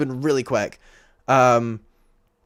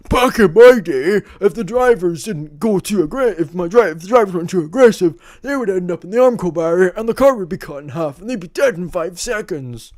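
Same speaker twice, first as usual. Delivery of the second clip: put-on old man ranting; 5 semitones higher, 4 dB louder than usual. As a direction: rant like a cranky grandpa. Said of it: "I sound like an old man shouting at a cloud".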